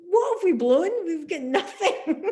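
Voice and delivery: high, loud giggling comeback; laughing retort. Retold or imitated. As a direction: shrug it off while laughing. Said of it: "like, going".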